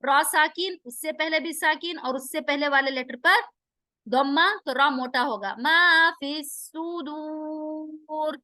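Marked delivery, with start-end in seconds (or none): 5.6-8.4 slow drawn-out tajweed chant; slow Quranic recitation. Shown as a demonstration. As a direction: recite each syllable slowly, melodically. Recites verse.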